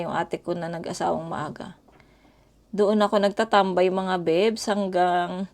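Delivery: usual speaking voice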